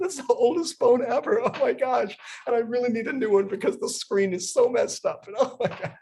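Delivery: high excited chatter through laughs; laughing story. Shown as a demonstration. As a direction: gush about the phone while laughing. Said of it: "by laughing the entire time".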